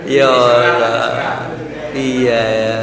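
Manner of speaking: low, drawn-out exclamations; slow, low, exasperated sighing tone; drawn-out dismay. Acted. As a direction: draw out each word in mock lament. Exclaims over the question's wording.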